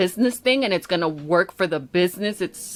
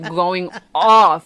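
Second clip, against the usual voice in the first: ordinary talk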